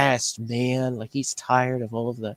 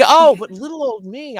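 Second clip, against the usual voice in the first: mock self-pity; 10 semitones higher, 6 dB louder than usual. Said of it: "It's like".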